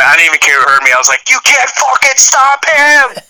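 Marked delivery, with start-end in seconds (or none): 1.3-3.2 high, slow mock yell; retold yell. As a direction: yell it slowly, pitched high and drawn out. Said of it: "I think I yelled at this point".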